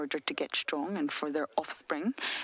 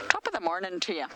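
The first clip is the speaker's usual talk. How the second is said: put-on terrible Irish accent, pitched up; mock Irish accent; 3 semitones higher, 3 dB louder, faster than usual. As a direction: greet them in a hammed-up Irish accent. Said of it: "That's my Irish accent".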